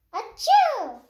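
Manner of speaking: high, loud hammed-up achoo; big sneeze. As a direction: blast out a cartoon sneeze. Gerald sneezes loudly.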